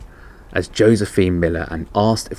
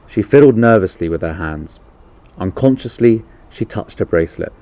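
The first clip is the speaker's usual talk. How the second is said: usual speaking voice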